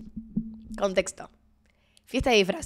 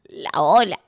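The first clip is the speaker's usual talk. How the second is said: normal talking voice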